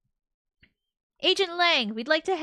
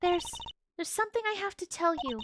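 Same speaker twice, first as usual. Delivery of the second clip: put-on timid young woman; 7 semitones higher than usual. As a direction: shrink back, afraid to intrude. Timid actress voiced while reading.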